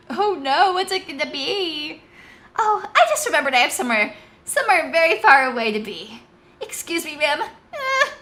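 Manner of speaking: frightened subordinate making excuses to flee; panicked escape. Acted. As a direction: bolt for the door in a fluster. Marcia tries to flee.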